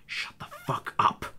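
unvoiced menacing whisper; whispered threat. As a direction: hiss it quietly in their ear. Dad telling off teens.

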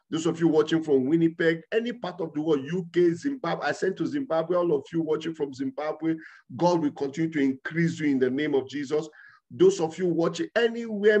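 ordinary delivery

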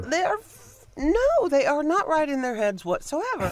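high pitched exasperated outburst. Real, not acted. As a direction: let the frustration push the voice up. Exasperated outburst about politicians.

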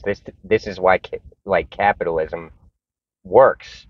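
usual speaking voice

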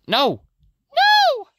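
very high, loud scream. Real, not acted. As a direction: scream no in horror. Disaster in game.

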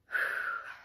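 long breathy exhale; nervous sigh. Real, not acted. Vlogger steeling herself to tell viewers, nervous exhale. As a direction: blow out a breath to steady nerves. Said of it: "I'm so nervous".

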